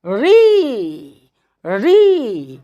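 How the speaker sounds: री said with a clearly tapped र; री with an audible र. Shown as a demonstration. Teacher showing students the र in री, clear and deliberate. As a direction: let the र in री ring out. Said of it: "र सुनाई दे रहा है ना".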